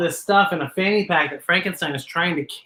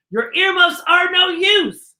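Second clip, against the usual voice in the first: excited dramatic reading; 10 semitones higher than usual. Acted. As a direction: build the thrill to a shout. Dramatic building reading, exclaims.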